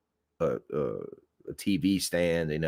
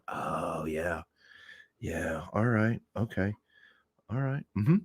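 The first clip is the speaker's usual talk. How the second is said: soft, stalling phone acknowledgments; pretending to listen; 6 dB quieter than usual. Driver fakes a call after boss hung up, quiet stalling. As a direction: murmur along to a dead line, with long gaps. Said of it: "he's not on the phone anymore".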